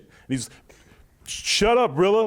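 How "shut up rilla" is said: high, loud mock-angry snap; mock mad. Imitating the rival losing an argument, mock mad. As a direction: imitate an irritated buddy cutting someone off. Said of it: "he got mad at someone".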